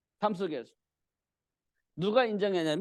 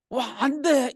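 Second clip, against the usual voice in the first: high, louder cry of dismay; imitated outcry; 6 semitones higher, 5 dB louder, slower than usual. Preacher voices the king learning Daniel is trapped, crying out. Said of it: "이렇게 이제 소리 짓겠죠".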